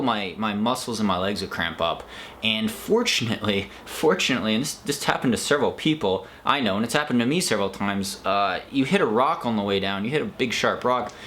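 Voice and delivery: ordinary talk, nothing marked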